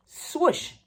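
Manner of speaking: high, airy swoosh like something darting off; whooshing swoosh. A narrator's reading. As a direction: make the swoosh of a fast escape. Pete catches Clover.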